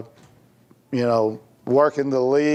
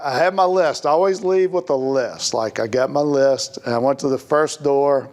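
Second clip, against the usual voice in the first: ordinary talk, nothing marked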